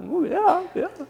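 high eager yeah trailing into nothing; eager listener. Acted. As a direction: play a keen listener hooked at once. Athenians eagerly answering Paul.

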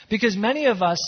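ordinary talk, nothing marked

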